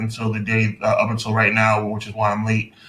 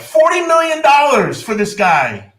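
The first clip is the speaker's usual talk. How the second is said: high, slow, disbelieving cry; can't believe the price; incredulous outrage; 13 semitones higher, 5 dB louder, slower than usual. Real, not acted. Outraged over player salary.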